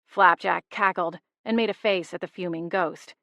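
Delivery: ordinary delivery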